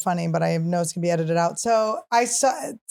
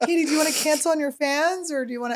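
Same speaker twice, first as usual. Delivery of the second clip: mock pushy handlers, voice pitched higher; 7 semitones higher, faster than usual. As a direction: play the pushy team cajoling Katy. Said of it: "they're like".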